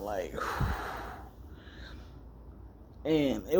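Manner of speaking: soft unvoiced sigh; letting out a sigh. Real, not acted. Calming down after the panic with a long sigh. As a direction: breathe it all out.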